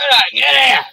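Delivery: loud, high shout at the dog; yelling at the dog. Real, not acted. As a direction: bark the order across the yard. Yelling at dog chasing possum.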